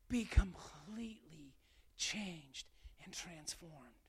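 hushed begging whisper as Paul; whispered plea. Acted. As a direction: whisper it like a desperate prayer. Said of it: "And Paul's begging".

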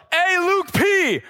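high, drawn-out calling yell; retold yell. Preacher re-enacting hailing Luke P, high yell. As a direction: holler for a celebrity across a crowd. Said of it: "and I just yelled".

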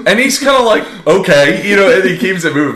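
high, loud, excited retelling; telling it with excitement, fast. Real, not acted.

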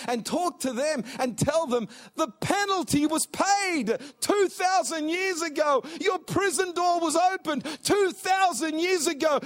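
loud, high, rising preacher's cry mimicking desperate sinners; full-force preaching. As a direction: preach it hard, pleading for the lost. Climax of altar call.

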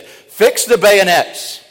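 "Fix the bayonets!" bellowed high and loud; shouted command. Retold or imitated. Commander shouting the order to his men, a full battle cry. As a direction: roar the order to charge. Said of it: "he made the command".

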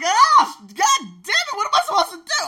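very high, very loud mock panic; freaking out. Overwhelmed by beautiful women, loud high mock panic. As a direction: shriek in comic despair. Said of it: "I'm like".

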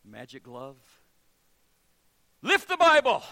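hammed-up incantation; mock magic command. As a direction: play the showman magician. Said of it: "he clears his throat and he goes".

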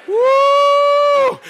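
high, ringing shouted woo; worship whoop. Real, not acted. Preacher whooping to the church in a joyful cry.

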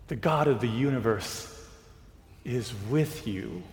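slow, soft preaching; sermon. Acted. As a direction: lay each phrase down gently.

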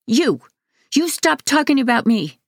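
put-on angry child shouting; enraged yell. A narrator's reading. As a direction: yell at him in rage. Enraged boy confronts staff.

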